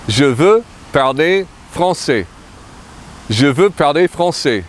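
je veux parler français, stress on veux; French pronunciation. Shown as a demonstration. Teacher models the whole sentence, careful French. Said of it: "I want to speak French".

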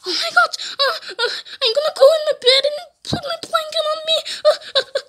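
high, fast frightened babble; play-acted panic. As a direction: babble in mock terror, pitch way up. Fleeing to bed in fright.

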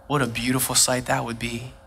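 usual speaking voice

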